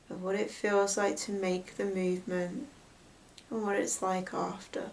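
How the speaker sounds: hushed, unhurried meditation voice with long pauses; guided meditation. Real, not acted. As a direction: gently guide them into noticing the breath. Leads a breath meditation.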